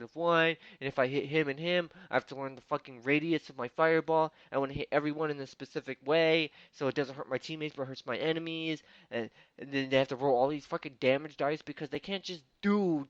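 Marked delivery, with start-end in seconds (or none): none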